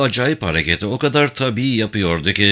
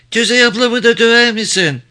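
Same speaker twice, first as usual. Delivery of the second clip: put-on very high, louder child's voice; piping little girl, pitched far up; little girl asking; 11 semitones higher, 5 dB louder than usual. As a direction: ask loudly as a small child. Small child asks question.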